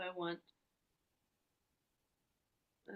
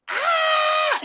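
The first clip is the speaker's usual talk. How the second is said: sudden full-throated scream; 19 dB louder than usual. Shown as a demonstration. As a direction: let out a loud scream. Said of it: "it feels like a scream".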